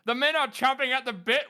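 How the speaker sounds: high, hammed-up military voice; mock officer's report. Reading a meme comment as an officer, mock-eager report. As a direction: announce it like an overeager officer. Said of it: "one of them is".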